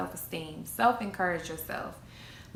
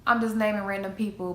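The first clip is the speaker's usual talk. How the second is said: normal talking voice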